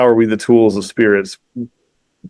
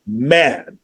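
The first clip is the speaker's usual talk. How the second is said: ordinary talk, nothing marked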